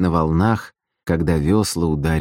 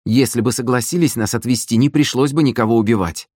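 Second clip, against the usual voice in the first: higher, aggrieved self-justification; hurt, childlike complaint; 6 semitones higher than usual. A narrator's reading. Sister blaming the dead oarsmen, childishly hurt. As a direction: whine it like a wronged child. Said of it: "обиженно, точно маленький ребёнок, пожаловалась Галка".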